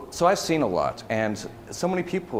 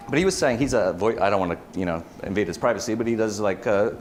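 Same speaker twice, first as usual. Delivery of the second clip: normal talking voice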